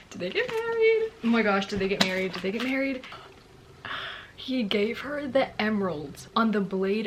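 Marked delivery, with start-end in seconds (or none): 0.1-3.0 excited disbelief. Real, not acted. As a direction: burst out in thrilled shock. Shocked realization while reading.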